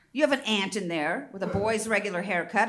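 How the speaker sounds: ordinary talk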